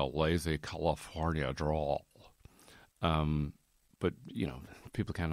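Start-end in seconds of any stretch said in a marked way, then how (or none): 0.0-2.0 slow, whispery lazy California accent; lazy California drawl. Shown as a demonstration. Demoing his camp American California accent for listeners. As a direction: let the words melt in a laid-back California accent. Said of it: "whenever I do an American accent".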